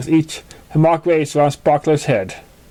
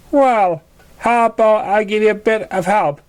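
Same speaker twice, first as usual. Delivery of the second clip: higher, kind helper voice; higher pony character voice; 6 semitones higher than usual. A narrator's reading. Sparkler offers Schuyler help, in a higher pony voice.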